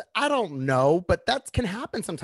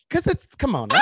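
loud excited burst; excited shout; 11 semitones higher, 6 dB louder than usual. Real, not acted. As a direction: shout it out, half laughing. Excited outburst about the toot.

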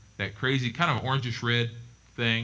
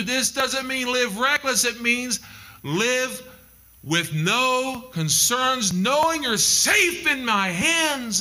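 high, building preacher's voice; impassioned pulpit preaching; rising preaching; 14 semitones higher, slower than usual. Acted. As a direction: climb into full sermon fervor. Preaches at full force.

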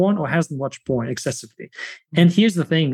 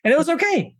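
said much higher, light and relieved; relieved, lifted; 11 semitones higher, faster than usual. Real, not acted. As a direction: float the words up in relief. Relieved punchline after laugh.

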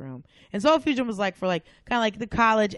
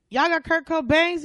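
tag; 9 semitones higher, 6 dB louder than usual. Retold or imitated. Customer asking clerk, retold.

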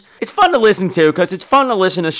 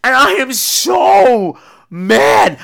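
ordinary talk, nothing marked

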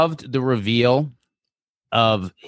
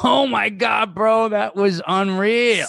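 high, laughing and thrilled; 10 semitones higher, slower than usual. Acted. Ghost Brian cracking up to Dan, thrilled. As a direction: gush like a delighted bro.

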